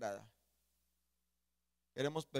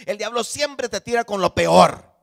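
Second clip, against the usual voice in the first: very loud, high pulpit cry; impassioned preacher at full volume, drawing out the climax; thundering preaching; 12 semitones higher, 19 dB louder than usual. Acted. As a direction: roar the warning to the congregation.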